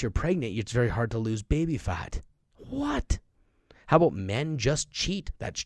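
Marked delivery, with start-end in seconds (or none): 2.6-3.2 disbelieving what. Real, not acted. Reacting to a silly belief.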